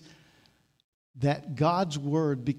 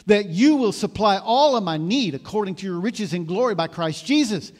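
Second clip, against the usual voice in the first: louder, rising grateful prayer; fervent thanksgiving prayer; 4 semitones higher, 7 dB louder than usual. Retold or imitated. Pastor modelling prayer to God, fervent and louder. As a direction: pray it out with confident gratitude. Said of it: "here, with thanksgiving".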